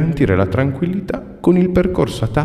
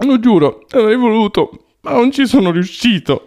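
tearful; 11 semitones higher than usual. Acted. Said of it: "Ma ti metti a piangere?".

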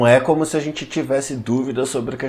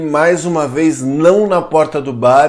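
podcast intro; 6 dB louder than usual. Acted. Podcast host opening.

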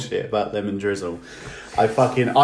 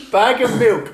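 delighted disbelief; 11 semitones higher, 6 dB louder, slower than usual. Retold or imitated. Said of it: "And I was like".

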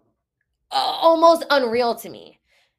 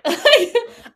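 high startled laugh; a sudden high laugh of disbelief; burst of laughter; 14 semitones higher, 8 dB louder than usual. Real, not acted. Woman laughing at the price she just heard.

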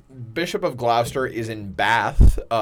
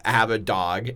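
usual speaking voice

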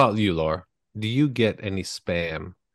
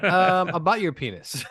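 high, laughing through the words; can barely get it out for giggling; amused disbelief, half laughing; 10 semitones higher, 4 dB louder than usual. Real, not acted. Laughing at a crude spam email.

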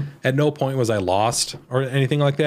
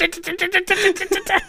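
mock machine-gun rattle. Imitating turret gunfire while laughing.

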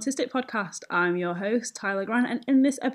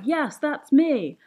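slow, drawn-out and louder delighted "yes"; excited recognition; 6 semitones higher, 6 dB louder, much slower than usual. Retold or imitated. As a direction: stretch it out like finally feeling seen.

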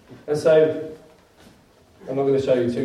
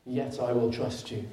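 quiet trust; 2 semitones lower, 7 dB quieter than usual. Acted. Quoting faith in hardship.

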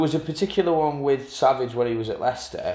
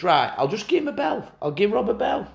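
higher, lightly voiced Keane; imitating Roy Keane; 9 semitones higher than usual. Keane deciding to ring Robbie, lightly voiced. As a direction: think it aloud as Keane. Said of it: "he thinks".

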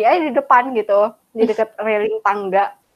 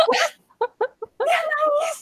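high, shocked question; much higher startled question; mock shock; 4 dB quieter, slower than usual. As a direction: play the gasp of disbelief. Shocked reaction retold.

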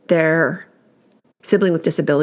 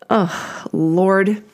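normal talking voice

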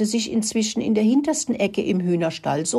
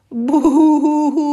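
high, loud boo-hoo; hammed-up high-pitched sobbing; mock wailing sob; 10 semitones higher, 8 dB louder than usual. A narrator's reading. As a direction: wail like a crying storybook rat. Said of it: "fing Rudi an zu weinen".

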